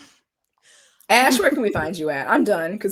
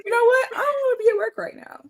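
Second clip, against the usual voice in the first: mock whiny complaint; faster than usual. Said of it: "And Reese being like".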